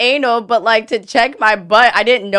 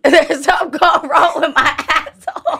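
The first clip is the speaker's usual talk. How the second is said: slow, higher, laughing through it; laughing confession; 4 semitones higher than usual. Real, not acted. Woman confessing a problem to the panel, laughing. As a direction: laugh your way through the admission.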